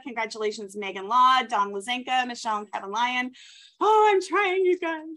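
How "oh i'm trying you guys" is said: exasperated. Real, not acted. Overwhelmed host rushing through names.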